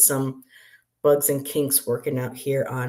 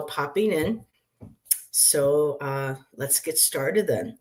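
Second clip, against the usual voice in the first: ordinary talk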